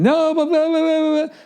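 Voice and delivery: very high blah-blah nagging; mock girlfriend babble. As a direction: squeal a stream of blahs. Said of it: "she's between the door".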